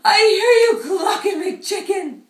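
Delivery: high, loud, happy cry; overjoyed to find mom; joyful chick. Acted. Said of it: "he says".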